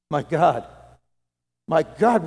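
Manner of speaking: loud cry of anguish. Acted. Jesus on the cross crying to God, loud anguished cry. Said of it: "cried out, in a loud voice".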